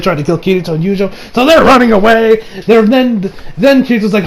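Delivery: ordinary talk, nothing marked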